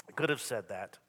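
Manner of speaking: ordinary talk, nothing marked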